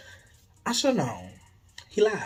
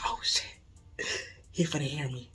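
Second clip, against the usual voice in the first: retold hushed worry; 10 dB quieter than usual. Retold sneaking out, creaking door.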